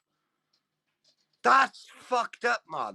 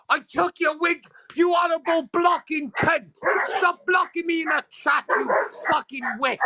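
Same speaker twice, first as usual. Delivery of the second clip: angry shout; 9 semitones higher than usual. Pretend. Yelling at the doll mascot.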